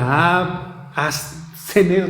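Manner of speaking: broken attempt at "absenes", restarting after the first A; hesitant sounding-out of "absenes" as a Greek word; unsure pronunciation. Shown as a demonstration. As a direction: feel your way through "absenes". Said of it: "no sé cómo se pronuncia".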